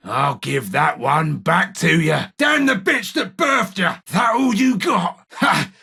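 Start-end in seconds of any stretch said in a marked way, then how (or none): 2.4-3.9 high, shouted insult; angry curse. Acted. Wounded fighter cursing whoever hit him, shouting high. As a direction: hurl the curse at the attacker.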